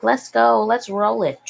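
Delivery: mock drunken rallying call; drunk enthusiasm. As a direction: imitate your tipsy self raring to go. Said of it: "when I'm drunk. I'm like".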